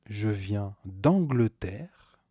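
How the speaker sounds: normal talking voice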